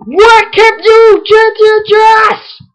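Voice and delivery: high, drawn-out screamed line reading; over-the-top screamed game dialogue; mock scream. Real, not acted. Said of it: "why I'm screaming it".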